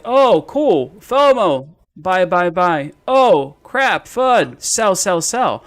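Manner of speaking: mock frantic trader, loud and high; panicky hype trader. Trader mocking impulsive investors who chase and flee, mock panic. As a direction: blurt each reaction high and loud, drawn out. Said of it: "we're just gonna be like".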